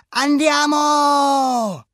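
high, long-held exuberant shout; excited battle cry. Acted. Alberto launches the Vespa downhill, exuberant shout. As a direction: holler it and stretch it out.

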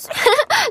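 hohes, kurzes Mädchenkichern; Kichern. A narrator's reading. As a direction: kichere über die lustigen Namen. Clara kichert über Namen. (Clara lacht über Frau Witzig: hohes Kichern)

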